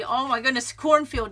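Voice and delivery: high, loud cry of realization; sudden realization. Real, not acted. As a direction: it just dawned on her. Sudden realization mid-sentence.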